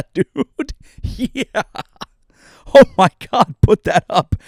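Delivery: high laughing yelps. Real, not acted. Reacting to a Bezos photo, high laughing yelps. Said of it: "laughing at Bezos photo".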